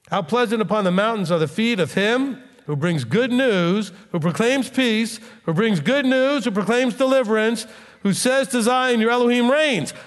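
rising, swelling proclamation; preaching scripture. Acted. Preacher proclaiming Isaiah to the congregation, swelling fervently. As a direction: preach the verse out to the hall.

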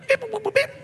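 high beeping noises; robot beeps. Shown as a demonstration. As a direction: beep and boop like a robot. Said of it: "do that and you'll both laugh".

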